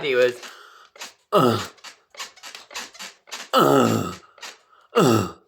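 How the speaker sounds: loud, hammed-up grunts of effort; mock grunting. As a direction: grunt it out like a parody of sex. Imitating dad's sex grunts.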